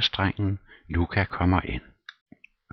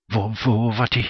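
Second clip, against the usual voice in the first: high, loud outraged gasp of a question; shrill outrage, louder; indignant cry; 6 semitones higher, 5 dB louder than usual. Acted. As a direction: shriek it at him, offended. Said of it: "skrige mig ind i ansigtet".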